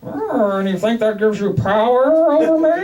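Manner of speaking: slow menacing villain voice; Bane voice. Retold or imitated. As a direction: taunt like Bane. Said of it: "doing Bane".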